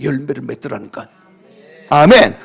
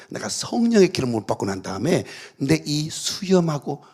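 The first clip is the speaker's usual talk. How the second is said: usual speaking voice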